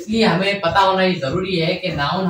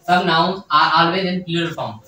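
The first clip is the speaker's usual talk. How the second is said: very slow, each word spaced out; slow dictation; much slower than usual. Shown as a demonstration. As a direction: read it out slowly for notes. Teacher repeats definition for dictation.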